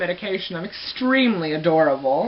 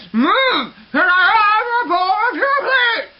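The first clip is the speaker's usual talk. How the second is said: irritated high, muffled yell; louder, drawn-out garbled shout at mom; getting louder; 12 semitones higher, 5 dB louder, much slower than usual. Retold or imitated. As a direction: holler the plea, high and mangled. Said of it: "you're just getting louder".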